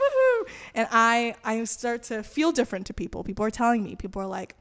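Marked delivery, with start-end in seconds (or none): 0.0-0.5 high, loud celebratory "woohoo"; high whoop. Retold or imitated. Acting out her joy at having God, high whoop. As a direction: let out an excited whoop.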